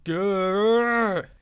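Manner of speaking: long loud growling roar. Acted. Diesel losing patience with the trucks, loud growl. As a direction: growl out in frustration. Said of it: "he roared".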